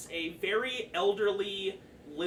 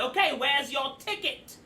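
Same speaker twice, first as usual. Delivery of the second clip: high, loud goblin ticket-seller; impatient goblin; 8 semitones higher, 6 dB louder than usual. Acted. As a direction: demand it as a harried goblin clerk. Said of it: "and he's like".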